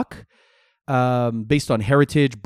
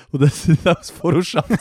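laughing; 3 semitones higher than usual. Retold or imitated. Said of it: "they go".

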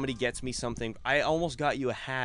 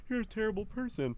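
mock reproach; 11 semitones higher than usual. Acting out mock reaction to smacking.

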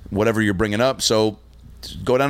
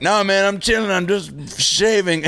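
put-on higher, slow, breezy drunk cover-up; drunk nonchalance; 11 semitones higher, slower than usual. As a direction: play it cool though you're wasted. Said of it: "And I'm like".

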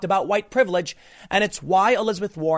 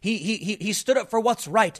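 mock excited supporters, higher-pitched; mock gushing; 4 semitones higher than usual. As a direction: imitate people freaking out over their hero. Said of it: "is everybody freaking out".

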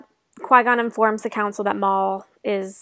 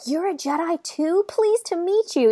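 a lightly voiced eager young boy, higher; chirpy kid voice, pitched up; little Anakin voice; 8 semitones higher than usual. Young Anakin meeting Obi-Wan, lightly voiced eager boy. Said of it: "And Anakin's like".

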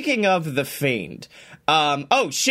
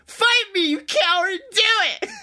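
much higher, whiny mocking taunt; mock taunting Seth; 12 semitones higher than usual. Mocking a wrestler's tweets, high whiny taunt. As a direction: parody a wrestler begging for a fight. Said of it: "Seth is on Twitter like".

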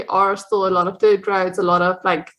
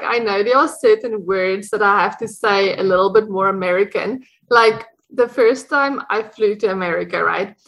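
ordinary delivery

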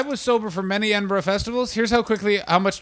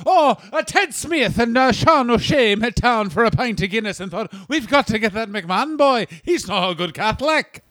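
high, hammed-up Irish accent; terrible Irish accent; 5 semitones higher than usual. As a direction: do a bad comic Irish accent.

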